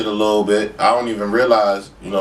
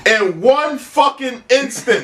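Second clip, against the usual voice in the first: laughing disbelief; 12 semitones higher, slower than usual. Real, not acted. Laughing at his bad luck.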